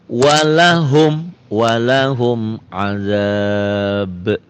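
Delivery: slow, melodic tajwid chanting; chanted Quranic reading; Quran recitation. Shown as a demonstration. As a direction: recite it slowly with drawn-out vowels. Recites example for idhar.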